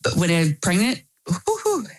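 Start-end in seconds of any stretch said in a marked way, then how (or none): 1.3-1.9 high whooping exclamation; whoo-hoo. Real, not acted. Describing pregnancy hormones, high whoop. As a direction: whoop at how wild it was.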